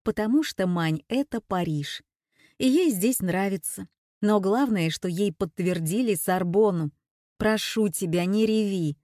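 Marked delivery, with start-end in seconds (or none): none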